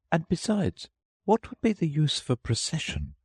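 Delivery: put-on high-pitched girl Alice, reasoning to herself; Alice thinking. A narrator's reading. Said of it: "thought she".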